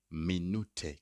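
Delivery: Minute in German, "mi-NOO-teh"; German pronunciation. Shown as a demonstration. Teacher modelling the word for learners, Minute as "mi-NOO-teh". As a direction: say Minute with an oo and a final eh. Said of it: "Kindly watch the pronunciation".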